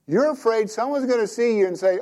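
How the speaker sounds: lightly mock his fear; teasing wife.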